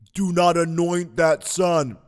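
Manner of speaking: slow, booming God voice; big movie-style voice of God, loud and drawn out; God's voice. Acted. God warning the man off anointing that son, booming. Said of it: "use God's voice with a real deep voice".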